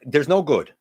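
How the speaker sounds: usual speaking voice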